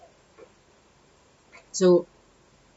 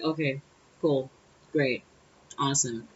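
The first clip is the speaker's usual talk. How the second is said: low, quiet, disinterested acknowledgements; unimpressed; 3 semitones lower, 6 dB quieter than usual. Retold or imitated. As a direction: drop each word as if it bores you. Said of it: "I'm not really impressed".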